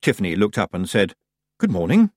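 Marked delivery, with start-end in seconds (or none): 1.6-2.1 high, rising polite greeting; tentative greeting. Acted. Tiffany greeting Death on being prompted, tentative. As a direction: offer it up like a question.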